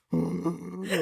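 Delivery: a high, sharp mock gasp. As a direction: gasp in fake shock. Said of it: "You're like".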